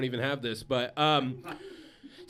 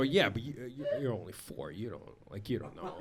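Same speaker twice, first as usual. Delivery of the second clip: low, soft, halting mumble, retold; retold meek comeback; 8 semitones lower, 11 dB quieter, faster than usual. Dad defending himself to his four-year-old, retold low and meek. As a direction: trail down into a small, defeated mock protest.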